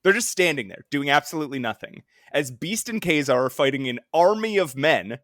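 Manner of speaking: ordinary talk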